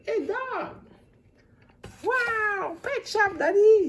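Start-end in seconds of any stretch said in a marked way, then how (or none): 2.0-3.9 voz de criança. Acted. Child George excited at pet shop.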